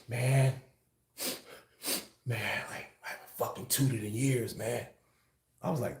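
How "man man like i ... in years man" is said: low, much softer, half-swallowed words; hushed, lowered voice admitting something; mumbled confession. Retold or imitated. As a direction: mumble it like you're ashamed to say it. Friend mumbling his confession.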